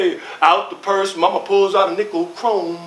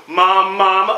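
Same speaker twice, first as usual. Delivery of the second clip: ordinary talk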